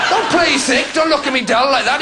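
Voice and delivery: ordinary delivery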